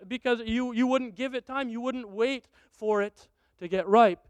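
normal talking voice

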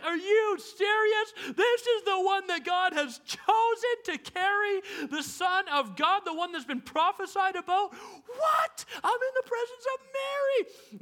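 high, loud, starstruck; an angel thrilled to meet Mary; pumped angel. Pretend. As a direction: gush like a starstruck fan. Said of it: "this angel probably was pumped".

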